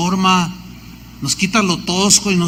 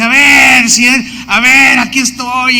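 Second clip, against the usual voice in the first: loud defiant taunt, pitched up; mocking shout; 5 semitones higher, 6 dB louder than usual. Ex-satanist taunting God in his retold story, loud mocking shout. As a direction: shout it up at the sky like a dare.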